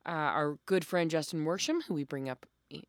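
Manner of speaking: ordinary talk, nothing marked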